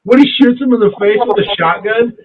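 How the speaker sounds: ordinary talk, nothing marked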